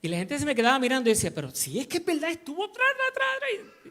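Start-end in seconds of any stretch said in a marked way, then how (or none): 1.3-3.9 very high-pitched imitation of gushing profanity; mock cursing. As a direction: climb into a squeal of nonsense swearwords. Acts out himself gushing with swearwords.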